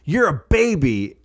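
put-on high, boastful child voice; imitating a snotty kid; bratty kid. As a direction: taunt like a six-year-old, drawing out the insult. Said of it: "And the kid goes".

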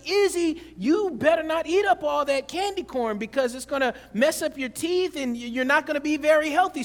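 mock-stern parental telling-off; stern dad warning. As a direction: lecture her like a strict father. Father scolding daughter about candy.

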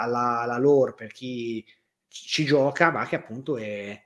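ordinary delivery

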